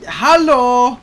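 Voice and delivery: loud, high exasperated call. Real, not acted. Player asks the game's pursuer to leave, calling out.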